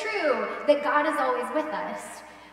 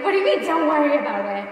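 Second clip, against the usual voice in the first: louder, incredulous complaint; exasperated wife; 9 dB louder than usual. Retold or imitated. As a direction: demand it, half-laughing and fed up.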